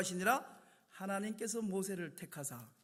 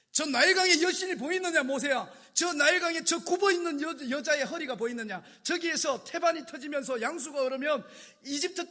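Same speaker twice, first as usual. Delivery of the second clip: higher, loud grandiose boast; boastful Pharaoh; 6 semitones higher, 8 dB louder than usual. Acted. Pharaoh showing Moses the Nile goddess, loud and boastful. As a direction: proclaim the goddess with pride.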